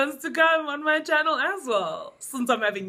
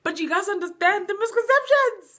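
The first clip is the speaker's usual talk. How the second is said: high, raised-voice insistence; exasperated outburst; 11 semitones higher, 6 dB louder than usual. Real, not acted. Host correcting friends on misconceptions, exasperated and loud. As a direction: burst out loudly over the others.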